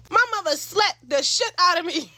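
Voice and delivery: ordinary talk, nothing marked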